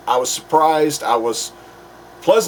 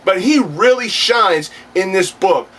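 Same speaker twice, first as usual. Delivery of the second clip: higher-pitched, emphatic alter-ego reviewer; Earth Two counterpart; 4 semitones higher than usual. Acted. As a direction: play the cocky alternate self, pitched up. Plays his Earth Two counterpart.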